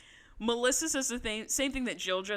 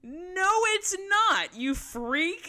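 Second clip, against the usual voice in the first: much higher, louder, slow exasperated retort; exasperated outburst; 9 semitones higher, 5 dB louder than usual. Retold or imitated. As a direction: fire back in disbelief. Holly snapping back at Jill.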